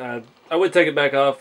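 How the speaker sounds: ordinary talk, nothing marked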